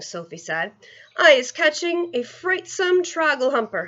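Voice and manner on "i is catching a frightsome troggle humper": cried. Acted. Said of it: "he cried".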